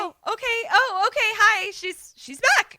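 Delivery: high, loud, scrambled welcome; hammed-up group welcome; flustered greeting. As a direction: babble a relieved hello. Group greets returning host, acted.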